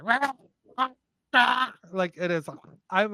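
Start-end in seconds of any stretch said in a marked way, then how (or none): 0.0-1.7 high squawking bird noise. Retold or imitated. Angry bird squawks at narrator, high squawk. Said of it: "Another bird's like".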